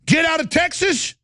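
high, squeaky put-on voice mocking the accusation; mock heckler. Host mocks what he is accused of saying, high mimic. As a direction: throw the line back in a shrill sarcastic mimic. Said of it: "did I say to you".

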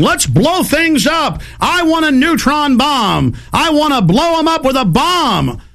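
high, slow hammed-up goon voice; mocking provocateur voice. Feds pretending to be violent agitators, hammed-up high voice. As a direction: ham up a dumb agitator, pitched high and slow. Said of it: "walking around going".

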